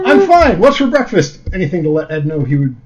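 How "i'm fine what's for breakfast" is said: high, forced casual; forced cheerful voice. Acted. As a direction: fake being totally fine. Al forcing normal.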